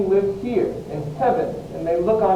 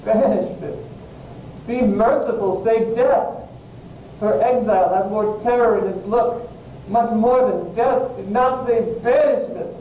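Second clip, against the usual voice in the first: raised, forceful dismay; distraught outburst, pitched up; anguished protest; 5 semitones higher than usual. Acted. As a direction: cry out in horror at the sentence. Romeo reacts to his banishment.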